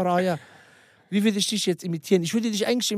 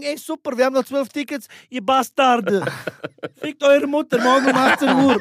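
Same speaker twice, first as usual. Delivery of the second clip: raised, hyped-up mock advertising; imitated aggressive promo; 4 semitones higher, 6 dB louder than usual. Imitating a friend's ticket promo story, loud and aggressive. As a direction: hurl the tour plug like a cheerful insult. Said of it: "Der macht Werbung".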